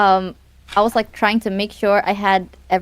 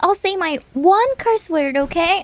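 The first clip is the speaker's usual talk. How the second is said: sweet voice; 7 semitones higher than usual. Retold or imitated. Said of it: "I was like".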